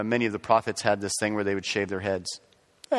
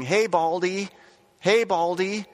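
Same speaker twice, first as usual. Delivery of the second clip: mock taunting yell; 7 semitones higher, slower than usual. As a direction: imitate kids jeering from a distance. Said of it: "they start yelling".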